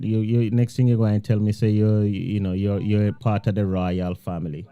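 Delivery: ordinary delivery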